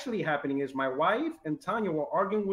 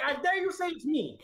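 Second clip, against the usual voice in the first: daring; 10 semitones higher than usual. Retold or imitated. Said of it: "She's like".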